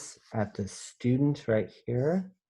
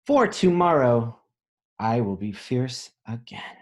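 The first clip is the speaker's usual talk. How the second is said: louder, hopeful picture-book ending; storybook read; 4 dB louder than usual. A narrator's reading. Teacher finishing a picture book for children, hopeful. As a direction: read the last line with hope.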